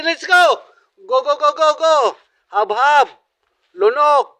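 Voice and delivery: loud go-go-go chant; cheering her on. Real, not acted. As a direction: pump up the eater. Cheering eater at the challenge start.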